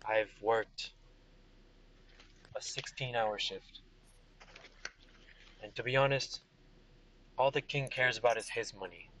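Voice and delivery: low, weary general; tired soldier. Acted. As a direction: sigh through a long shift. GM plays tired Vega.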